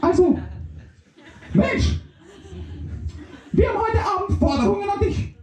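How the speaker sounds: high, excited little microbe; squeaky microbe voice. Acted. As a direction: chirp it up high and fast. Microbe states demands.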